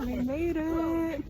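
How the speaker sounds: out of breath, slow and soft; panting after the climb; breathless at high altitude. Real, not acted. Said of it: "I'm out of breath from the altitude".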